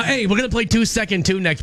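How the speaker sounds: ordinary delivery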